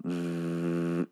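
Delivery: slow, soft imitated vibrating buzz; drawn-out zzz like a vibrating toy; buzzing noise. Shown as a demonstration. Host imitating a sound heard in the episode, buzzing. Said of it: "you can hear a soft zzz".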